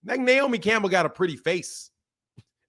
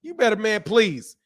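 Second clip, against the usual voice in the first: ordinary talk, nothing marked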